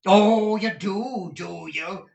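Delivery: high, mocking sneer. Squeers sneering at Nicholas's answer, high and mocking. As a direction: drip scorn on each word. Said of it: "sneered Squeers".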